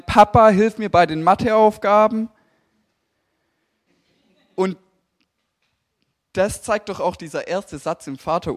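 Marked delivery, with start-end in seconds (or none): 0.0-2.3 loud, higher call to dad for help; mock kid's yell from another room; imitated sister shouting. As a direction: holler it across the house like his sister. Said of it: "Meine Schwester von ihrem Schreibtisch schreien".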